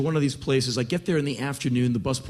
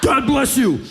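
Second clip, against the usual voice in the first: high, loud crazed blessing; deranged street beggar; 11 semitones higher, 6 dB louder than usual. Acted. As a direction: yell it like a raving madman. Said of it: "old grizzled fifty-something-year-old psychotic men".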